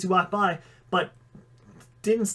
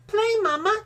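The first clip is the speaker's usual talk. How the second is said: put-on much higher pouting little child voice; pouting toddler. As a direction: pout like a small kid. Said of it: "Junior pouted".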